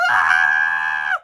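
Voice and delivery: loud, sudden shriek; terrified scream. Acted. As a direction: scream mid-ride. Screaming on the ride.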